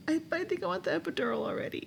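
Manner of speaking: high, quick, thin mock whimper; pained imitation of begging for relief; whimpering plea. Laboring wife whimpers to husband for the epidural. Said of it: "I was whimpering too".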